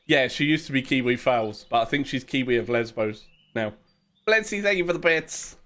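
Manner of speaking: normal talking voice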